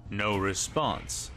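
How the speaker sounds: ordinary delivery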